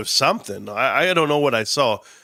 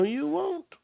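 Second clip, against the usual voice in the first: high squeaky warning; 14 semitones higher, 9 dB quieter than usual. Acted.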